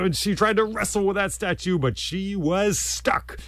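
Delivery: rising frantic telling; excited narration. A narrator's reading. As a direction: build the struggle high. Struggle rising.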